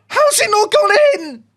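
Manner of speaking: very high, voice-wrecking shriek; disbelieving shriek. Real, not acted. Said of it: "if I shriek like that".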